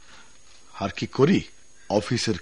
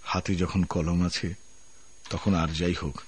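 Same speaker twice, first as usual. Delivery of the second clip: letter voiceover. Acted. Resignation letter read in voice.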